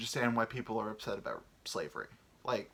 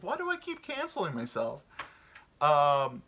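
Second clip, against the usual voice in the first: usual speaking voice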